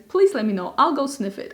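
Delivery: ordinary talk, nothing marked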